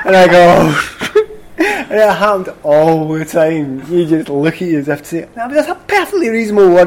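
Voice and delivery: laughing out the punchline. Real, not acted. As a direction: still laughing, loud. Still laughing telling story.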